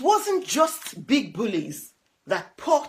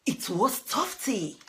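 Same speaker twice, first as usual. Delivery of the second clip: ordinary delivery, nothing marked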